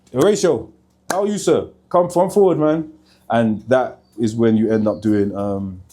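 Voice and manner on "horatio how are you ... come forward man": higher-pitched, friendly hail; calling someone over. Real, not acted. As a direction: greet him and beckon him over, pitch lifted. Calls a passing colleague over.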